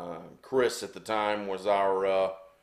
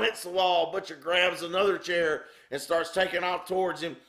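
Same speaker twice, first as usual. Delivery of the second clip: ordinary delivery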